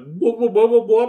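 high whooping pipe noise; warp pipe whoop. Acted.

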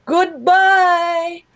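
put-on very high, loud old-lady singsong; hammed-up high goodbye with hooting; grandma's cheery farewell. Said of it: "And she's like".

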